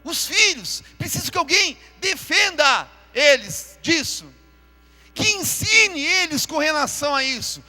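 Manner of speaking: loud, driving sermon delivery, hammering each figure; preaching at full force. Acted. Pastor warning the church about drugs, preaching loudly.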